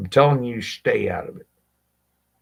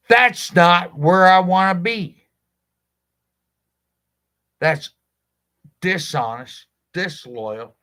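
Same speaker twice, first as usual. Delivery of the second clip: forceful; 9 semitones higher, 8 dB louder than usual. Real, not acted. Passionate rant about his work.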